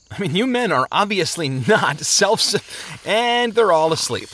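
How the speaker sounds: high protest breaking into disbelief; argue, then break off incredulous; exasperated. Acted. Traveler sees the men asleep mid-argument, exasperated.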